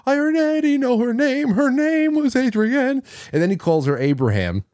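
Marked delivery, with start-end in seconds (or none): 0.1-3.0 imitating Mike. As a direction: squeak it out high to mock his fussiness. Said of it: "He said".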